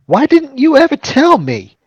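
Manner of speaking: high, loud betrayed whine; mock indignant. Player mocks a son never told of his family, indignant.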